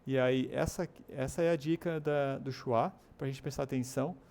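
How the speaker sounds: usual speaking voice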